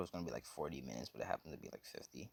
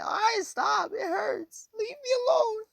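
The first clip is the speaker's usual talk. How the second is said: very high, loud bawling falsetto; hammed-up tearful shriek; mock crying; 10 dB louder, slower than usual. Slapped woman begs her attacker to stop, shrieky mock crying. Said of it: "she starts crying".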